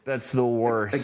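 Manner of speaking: slow, stretched mock repeat; slow drawn-out repeat. Repeating the joke to cohost, slow drawn-out. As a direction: drag it out for a laugh.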